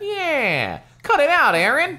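loud, high wise-guy shout. Acted. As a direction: cut in like an indignant wise guy. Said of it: "like a wise guy".

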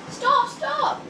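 high, loud plea to halt; alarmed whistling cry. A narrator's reading. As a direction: cry out as a runaway little engine.

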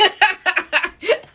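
high, loud laugh; real burst of laughter. Real, not acted. Laughs at the invitation.

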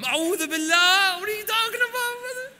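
high, loud, stretched-out outburst; mock horror. Brother scandalised by a doubtful answer, high mock horror. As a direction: wail it in hammed-up outrage.

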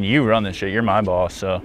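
usual speaking voice

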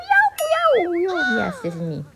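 ordinary delivery

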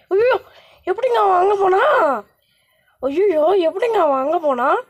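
high, wailing exclamation of surprise; startled disbelief. Real, not acted. Gamer seeing the baby somewhere else, startled disbelief. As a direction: cry out, unable to believe it.

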